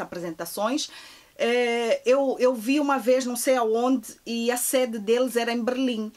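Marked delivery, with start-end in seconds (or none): none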